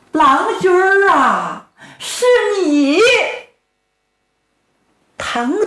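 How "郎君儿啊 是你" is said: 音调拔高、拖长的女子哭腔; 动情地认出自己的丈夫; 妻子惊呼. A narrator's reading. 妻子突然认出丈夫. (女声惊喜哭喊)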